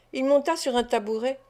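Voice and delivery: usual speaking voice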